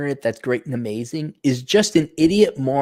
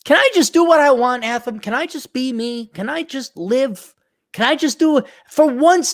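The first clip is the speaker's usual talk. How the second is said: higher, whiny can I just; exasperated pleading; 9 semitones higher than usual. Real, not acted. Streamer whining to chat to be left alone. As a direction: beg for freedom.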